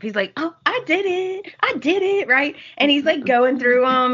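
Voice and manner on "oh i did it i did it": put-on excited, proud child. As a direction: mimic a little boy thrilled with himself. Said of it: "he's all excited, like, he's all proud of himself".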